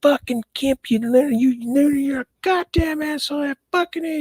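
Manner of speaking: high-pitched imitation of a furious girlfriend; mock screaming insults. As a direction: squeal the insults like she's losing it. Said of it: "calling me".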